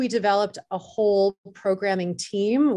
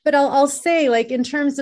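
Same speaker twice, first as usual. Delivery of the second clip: loud interruption; 6 semitones higher, 5 dB louder than usual. Real, not acted. Cuts in over the other speaker.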